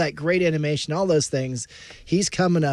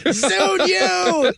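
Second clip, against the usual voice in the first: laughing; 11 semitones higher than usual. Real, not acted. Joke retort, laughing.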